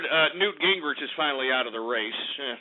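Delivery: usual speaking voice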